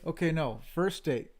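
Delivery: ordinary talk, nothing marked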